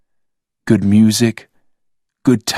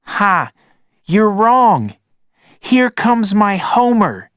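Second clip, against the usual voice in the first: put-on high, excited boy voice; child's shout; 12 semitones higher, 3 dB louder than usual. A narrator's reading. Logan firing his pretend Homer at Rob, high shout. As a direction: shout back like a kid at play. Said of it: "shouted Logan".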